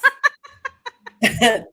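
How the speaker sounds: a high, loud laugh bursting out; loud burst of laughter. Real, not acted. Guest bursts out laughing at the reason. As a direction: crack up at her friend's answer.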